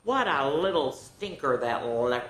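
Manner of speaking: ordinary delivery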